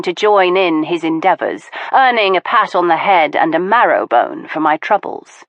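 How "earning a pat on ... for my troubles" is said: ordinary delivery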